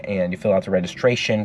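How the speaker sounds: ordinary talk, nothing marked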